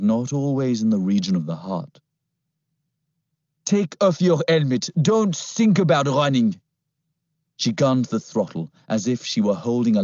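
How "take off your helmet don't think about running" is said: higher, cool French command; French-accented orders. Acted. Captor ordering the boy off the scooter, French accent. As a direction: order him without raising the voice.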